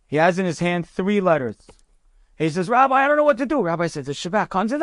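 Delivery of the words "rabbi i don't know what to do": high-pitched, anxious appeal; worked-up, helpless plea in a higher voice; nervous soldier. Retold or imitated. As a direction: act out a nervous private at a loss. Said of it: "I'm so nervous".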